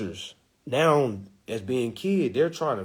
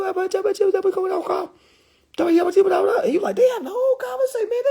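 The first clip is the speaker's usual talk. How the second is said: put-on squeaky high baby chatter, much higher and faster; baby babble; 5 dB louder, faster than usual. Babies having a whole conversation, squeaky baby talk. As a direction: babble like two babies gossiping.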